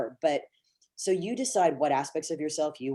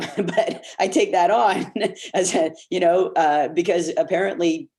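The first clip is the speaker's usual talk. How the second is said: loud, higher, laughing through the words; real laughing; 4 semitones higher, 8 dB louder than usual. Real, not acted. Actor amused after showing off a dialect, laughing.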